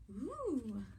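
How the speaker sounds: ordinary delivery, nothing marked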